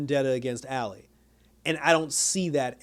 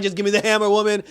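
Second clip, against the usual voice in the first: impatient demand; 5 semitones higher, 5 dB louder, faster than usual. Retold or imitated. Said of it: "he was just like".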